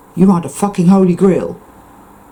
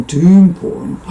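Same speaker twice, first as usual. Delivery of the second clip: ordinary talk, nothing marked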